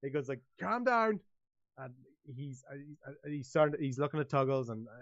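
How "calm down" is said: high, mock-angry command. Somebody angrily telling another to settle, acted. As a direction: demand calm in a worked-up pitch. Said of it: "in a really angry voice".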